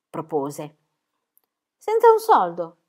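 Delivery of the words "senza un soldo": high, loud disbelieving question; Tommy surprised, high and loud. Acted. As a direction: ask it in amazement. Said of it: "chiese Tommy".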